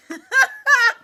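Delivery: high-pitched burst of laughter. Real, not acted. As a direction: crack up at the absurdity. Laughing at the card.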